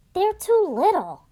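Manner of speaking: dad fussing that the dog is too little; dad's voice. Acted. Dad's quote voiced in story.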